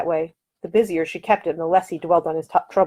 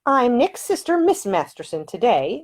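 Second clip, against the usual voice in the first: prim; 7 semitones higher, slower than usual. A narrator's reading. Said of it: "she said primly".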